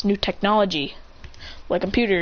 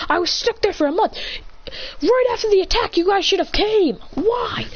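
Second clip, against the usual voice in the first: hurt rant; 9 semitones higher than usual. Acted. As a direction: pour out the betrayal to the father. Son rants at father, nearly crying.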